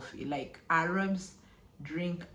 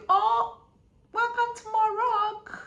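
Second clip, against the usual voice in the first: high, bright-pitched welcome from the airport officer; cheery greeter; 6 dB louder than usual. Retold or imitated. Officer greeting the arriving traveller, high and cheery. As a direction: put on the officer's much higher greeting voice. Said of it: "So this guy was like".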